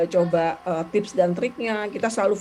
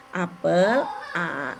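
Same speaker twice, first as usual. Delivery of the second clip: APEL drawn out, then a lower A; modelling a prompt; 3 semitones lower, much slower than usual. Shown as a demonstration. Therapist showing parents how to prompt, drawn-out model word. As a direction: stretch APEL, pause, give A as the cue. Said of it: "saya menyarankannya gini".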